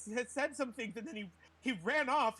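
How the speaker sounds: high, stammering distressed character; mimicking her voice. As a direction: play her flustered, tripping over words. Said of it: "I'm mimicking her voice".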